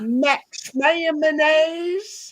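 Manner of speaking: slow, stretched Southern drawl on Max Mayonnaise; slurred Southern drawl, Mayonnaise stretched. Shown as a demonstration. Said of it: "Southern drawl".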